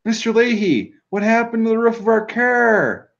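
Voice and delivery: high Canadian voice asking about the car; high, lilting Canadian question; Canadian accent. Retold or imitated. Mimics Canadian accent quote.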